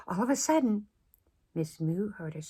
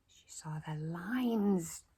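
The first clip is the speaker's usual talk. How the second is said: ordinary delivery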